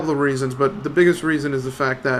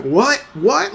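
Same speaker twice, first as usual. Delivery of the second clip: aggressive what; 7 semitones higher than usual. Retold or imitated. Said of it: "he was so aggressive".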